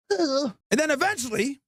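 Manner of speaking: ordinary delivery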